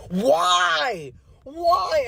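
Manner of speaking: high, loud wailing why; pleading with the laughing manager, high and loud; mock anguished cry. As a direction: cry out in exaggerated despair. Despairing at manager.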